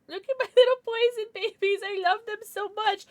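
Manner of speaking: high, loving gush. Real, not acted. Streamer professing love for her plushies, high doting gush. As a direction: coo high, a laugh slipping in. Dotes on plushies, near tears.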